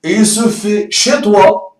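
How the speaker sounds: ordinary talk, nothing marked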